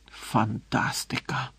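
slow, hushed, sarcastic mutter; angry mutter. A narrator's reading. Harry at the closed door, muttering angrily. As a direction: grumble it under your breath. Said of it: "сердито пробурмотів Гаррі".

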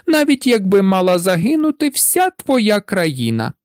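high, snooty princesses' refusal; haughty, pouting. A narrator's reading. Elder princesses refusing their father's demand, haughtily. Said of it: "надули губи і пихато відповіли".

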